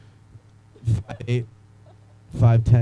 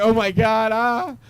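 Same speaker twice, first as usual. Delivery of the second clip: mock freakout; 6 semitones higher, slower than usual. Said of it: "What if I was like".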